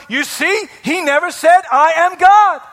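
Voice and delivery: loud, high mock triumph; big-headed scholars. Preacher mimics scholars scoring a point, loudly smug. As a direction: crow it like a know-it-all, rising in volume. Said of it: "they like to say things like".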